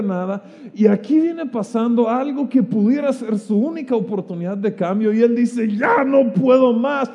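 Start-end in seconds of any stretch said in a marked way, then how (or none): 5.7-7.1 at the end of his rope, screaming high; desperate scream. Retold or imitated. Fed-up beggar screaming Jesus' name. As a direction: let the despair break into a yell. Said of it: "empieza a gritar desesperadamente".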